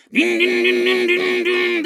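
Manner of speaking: high, monotone droning dings; imitated motor noise. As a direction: mimic a little bike taxi buzzing along. Imitates bike taxi sound.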